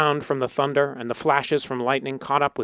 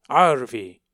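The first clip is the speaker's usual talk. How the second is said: louder booming call; roaring sky; 5 dB louder than usual. A narrator's reading. Sky roars a spell at the soldiers, loud roar. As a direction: hurl the word like thunder. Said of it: "the sky roared".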